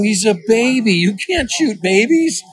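high, slow gently scolding; gentle father. Retold or imitated. Father telling daughter the fawn is a baby, slow and gentle. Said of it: "I go".

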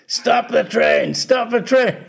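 high, drawn-out mock scream; retold yelling. Musician retelling a friend's panic at the station, mock yelling. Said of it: "yelling and screaming".

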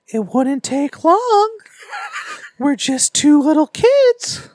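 put-on creepy child voice. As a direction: sound like a sinister child. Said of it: "[name] using that voice is creeping me out".

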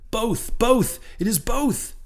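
high, jubilant cry, loudest on the second word; joyful shout. A narrator's reading. Harpooner seeing both men surface, joyful shout. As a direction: burst out in relief and joy. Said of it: "again with a joyful shout".

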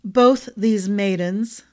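Both these "maidens", with a weighted pause after; emphasis on maidens. A narrator's reading. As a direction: lean on "maidens" pointedly. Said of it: "Camwell paused to put emphasis on the word".

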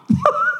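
a short burst of laughter up high; bright real laugh that jumps up high; sudden high laugh. Real, not acted. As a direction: laugh right out at the joke. Laughs at his own joke.

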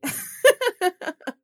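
high loud laugh. Real, not acted. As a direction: burst out laughing. Laughs at own joke.

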